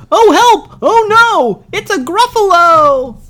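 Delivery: very high, loud panicked squeal; terrified mouse. Acted. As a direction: mouse shrieking at the monster.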